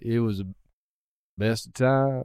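"It was the", long pause, then "best of times"; pause after "It was the". Shown as a demonstration. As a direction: hang on "the" before "best of times". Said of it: "do an unnecessary pause after".

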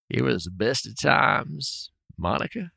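very slow, soft southern drawl; Bill Clinton's slow Arkansas drawl; Bill Clinton impression. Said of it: "He's so close to Bill Clinton".

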